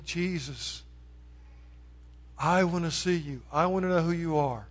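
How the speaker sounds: soft, intimate plea; quiet prayer. Shown as a demonstration. Preacher inviting listeners to pray, soft and intimate. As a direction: murmur it as a personal prayer. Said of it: "Fall on your knees and say".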